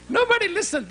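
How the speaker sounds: high, laughing exclamation; amused laugh through the words. Real, not acted. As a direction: laugh it out at the crowd. Laughing at audience mistake.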